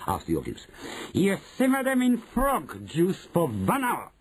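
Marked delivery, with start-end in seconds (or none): 1.1-4.1 high, emphatic witch voice; Grand High Witch, instructing. Acted. Grand High Witch answering about the mice, instructing.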